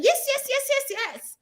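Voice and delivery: high, rapid yes yes yes; mock overeager yes-man; eager yeses. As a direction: gush agreement way up high. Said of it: "instead of saying".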